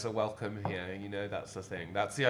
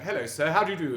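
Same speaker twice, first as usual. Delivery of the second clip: higher, louder prim English greeting voice; polite standard English greeting; 6 semitones higher, 7 dB louder, faster than usual. Acted. Showing what English accents are not like, mock-proper English voice. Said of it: "It's not like".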